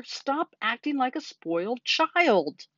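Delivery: high, slow, drawn-out scolding; exasperated scream. A narrator's reading. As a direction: cry out slowly in frustration. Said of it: "screamed Wilbur".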